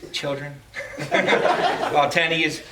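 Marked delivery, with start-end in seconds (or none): 0.6-1.9 breathy laugh. Real, not acted. Laughs at calling his children.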